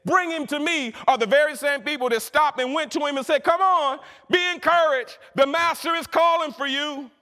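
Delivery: impassioned preaching. Real, not acted. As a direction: proclaim it with rising fervour. Preaches at full force.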